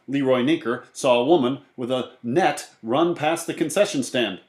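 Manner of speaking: ordinary delivery, nothing marked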